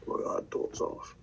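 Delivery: breathy whispered teasing chant; whispered taunt. Pretend. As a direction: hiss the taunt under his breath. Teasing catchphrase repeated.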